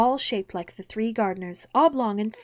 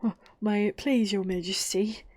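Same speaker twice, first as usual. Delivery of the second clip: half-whispered, cowed address; very humble. A narrator's reading. Gardener kneeling before the Queen, very humbly. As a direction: speak meekly, as if kneeling. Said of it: "in a very humble tone".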